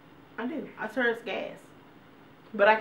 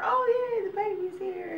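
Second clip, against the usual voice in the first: very high cheerful news; happy announcement; 14 semitones higher than usual. Retold or imitated. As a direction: announce the baby brightly. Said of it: "we tell her".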